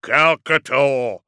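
slow, loud dreadnought voice; booming call. A narrator's reading. Dreadnought demands an answer from his enemy, booming. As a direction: boom the name, drawn out. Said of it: "rumbled from Magnoric's vox emitter".

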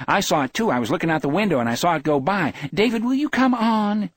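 high-pitched rush building to a plea; excited, insistent. A narrator's reading. Wife reporting the van and hurrying husband, excited insistence.

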